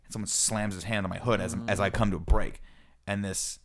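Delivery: ordinary talk, nothing marked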